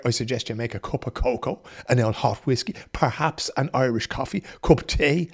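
ordinary talk

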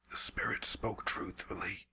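put-on weak whispered old man voice; breathless rasping whisper, barely alive; frail old man whispering. Old shaman, awed whisper.

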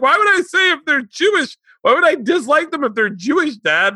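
put-on high, loud indignant kid; indignant daughter voice. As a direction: play the offended daughter. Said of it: "And she's like".

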